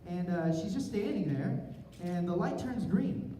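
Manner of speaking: ordinary talk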